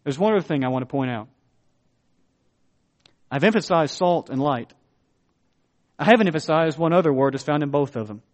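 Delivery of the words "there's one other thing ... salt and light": ordinary talk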